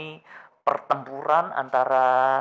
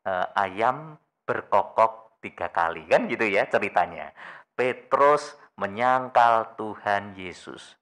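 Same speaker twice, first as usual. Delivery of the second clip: normal talking voice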